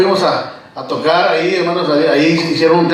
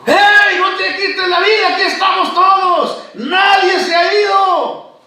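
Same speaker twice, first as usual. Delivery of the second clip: Paul shouting; 12 semitones higher than usual. Acted. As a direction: shout it out to stop him, urgently. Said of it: "el apóstol Pablo le grita". (grito fuerte y agudo, urgente)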